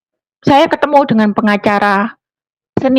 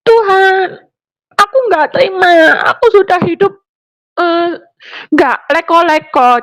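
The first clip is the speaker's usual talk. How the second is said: protesting shout; 6 semitones higher than usual. Retold or imitated. Alone in her room protesting to God, raised angry cry. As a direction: hurl the complaint upward like a half-shout. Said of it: "Teriak, bukan berdoa, protes".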